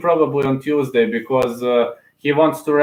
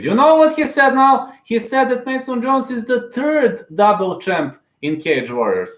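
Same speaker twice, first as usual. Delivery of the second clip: indignant rant; 11 semitones higher than usual. Real, not acted. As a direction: fume at the commentators. Ranting at TV commentators.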